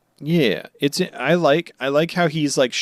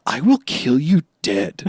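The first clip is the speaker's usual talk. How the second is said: Sanji threat. Retold or imitated. Sanji voiced in recap.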